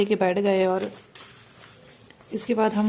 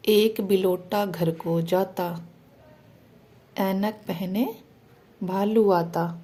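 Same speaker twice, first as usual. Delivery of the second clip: put-on children's rhyme; 2 semitones lower, much slower than usual. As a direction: recite it gently for little ones. Reciting rhyme to kids.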